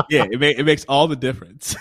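amused, emphatic agreement; laughing agreement. Real, not acted. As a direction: agree heartily, still laughing. Shared laugh after empathy remark.

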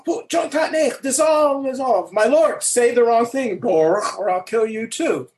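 high-pitched dramatic run-through; heated acted Klingon dialogue; acted Klingon film scene. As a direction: race through the take as an angry Klingon commander. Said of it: "So, da, da".

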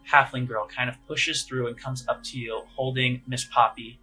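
ordinary talk, nothing marked

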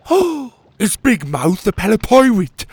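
high, loud, amazed question; awestruck. A narrator's reading. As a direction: gush with wonder at the hero. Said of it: "He looked at the bird in awe".

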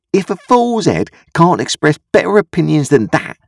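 high-pitched, scornful sister; scolding sister. A narrator's reading. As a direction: tell off a fool in a high voice. Sister scolds Joe in the story.

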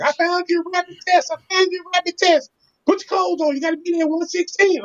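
excited wife. Retold or imitated. Wife bursting in with news.

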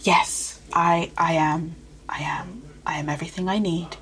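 quiet, whispered self-affirmation. Retold or imitated. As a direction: whisper I am to yourself. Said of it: "And it's like".

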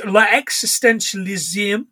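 EXISTENTIALISM in a slow French accent; mock French accent. Shown as a demonstration. Podcast host attempting the philosophical term in a mock French accent.

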